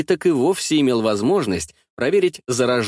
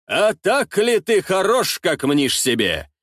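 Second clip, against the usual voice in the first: higher, slow, drawn-out taunt; mild challenging cry; 6 semitones higher, slower than usual. A narrator's reading. Ostap challenges a rival before the tsar, mild taunting cry. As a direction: call out the challenge, stretching the words. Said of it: "выкрикнул Остап".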